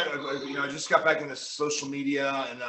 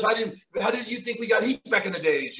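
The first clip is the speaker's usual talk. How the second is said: imitated French Canadian accent, higher pitched; Pat Patterson; 7 semitones higher, 4 dB louder than usual. Veteran explaining heat to wrestlers, imitated French Canadian accent. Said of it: "he's like a strong Canadian French accent".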